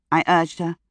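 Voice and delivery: plain reading voice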